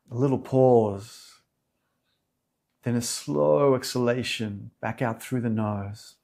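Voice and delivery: calm meditation guide, unhurried with long pauses; soothing yoga teacher leading a meditation; guided meditation. Real, not acted. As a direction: settle them gently into stillness, phrase by phrase.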